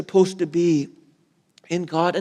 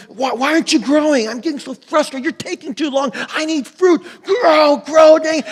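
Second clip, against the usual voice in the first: high, exasperated rant building to a shout; frustrated farmer; 11 semitones higher, 8 dB louder than usual. Acted. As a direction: act out a farmer losing patience, louder and louder. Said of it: "just imagine, like, a farmer says".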